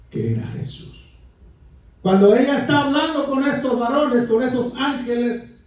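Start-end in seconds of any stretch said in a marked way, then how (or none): 0.1-1.1 low, whispered narration; very soft, low storytelling aside; hushed reveal. A narrator's reading. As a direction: let it fall away to a whisper.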